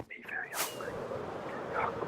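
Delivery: soft, voiceless imitation of a whisper; retold whisper. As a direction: whisper it, mimicking the man behind you. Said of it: "he whispers in his ear".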